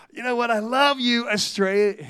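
put-on accidental American accent on the estate agent; a brief slide into an American accent; slipped American accent. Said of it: "I nearly went American then".

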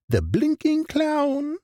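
plain reading voice

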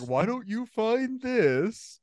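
drawn-out mock dad asking you to search; imitating dad. Dad voiced in Menards story.

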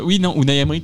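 ordinary talk

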